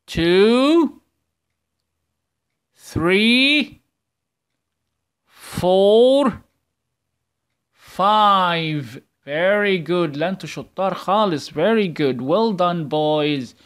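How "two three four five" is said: each number called loud and high, slow gaps; teacher calling numbers for pupils to echo; loud counting. Shown as a demonstration. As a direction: lead the class count at full voice. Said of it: "سمعوني بصوت عالي".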